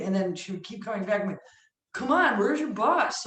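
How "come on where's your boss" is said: high, loud mock-exasperated demand; impatient pestering. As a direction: badger them playfully for an answer. Retold pestering of the assistant.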